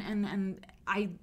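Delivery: usual speaking voice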